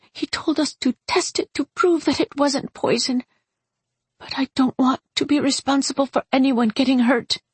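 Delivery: high-pitched, upset explanation; distressed pleading. A narrator's reading. Tearful Dolly explains her plight.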